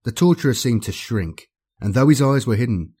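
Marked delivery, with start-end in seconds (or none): none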